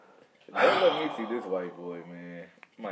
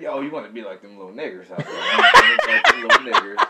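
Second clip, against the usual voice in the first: mock Tom Hanks; 10 dB louder than usual. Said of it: "He said".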